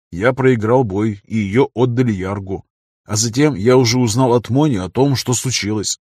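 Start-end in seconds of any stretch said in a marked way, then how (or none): none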